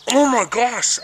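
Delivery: high, loud "Oh my gosh"; shocked cry. Retold or imitated. Seeing smoke from dad's mouth, son cries out in shock. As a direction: blurt "Oh my gosh" in horror. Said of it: "And he's like".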